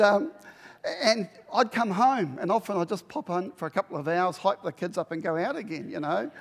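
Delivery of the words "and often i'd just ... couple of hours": ordinary delivery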